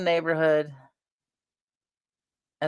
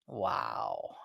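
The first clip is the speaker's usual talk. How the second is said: dumbfounded hush; 5 semitones lower, 3 dB quieter, much slower than usual. Retold or imitated. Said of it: "And I was like".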